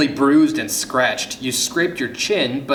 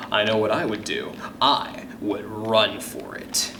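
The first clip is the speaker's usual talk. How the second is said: ordinary delivery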